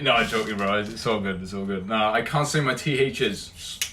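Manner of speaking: ordinary delivery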